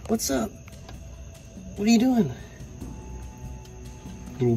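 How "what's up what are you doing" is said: much higher cooing voice. Real, not acted. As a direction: coo at the little caiman. Cooing at his caiman.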